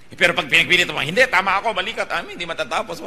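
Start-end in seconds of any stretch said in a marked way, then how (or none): 1.0-3.0 higher and louder, retelling an insistent quarrel; mock-argue, refusing to back down; stubborn insistence. Arguing sibling insists she is right, mock angry.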